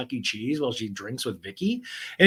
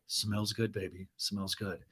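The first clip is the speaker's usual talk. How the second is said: much softer, lower crooning; low, soft Travis; 5 semitones lower, 11 dB quieter than usual. Retold or imitated. Travis praising Gina's cooking, low and soft. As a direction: murmur it lazily, low and soft. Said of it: "And Travis is like".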